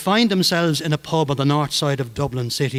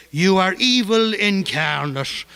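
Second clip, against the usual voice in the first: put-on slow, higher Monaghan accent, leaning on evil; 4 semitones higher, slower than usual. Kavanagh yelling across the street at Behan, Monaghan accent. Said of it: "in that Monaghan accent".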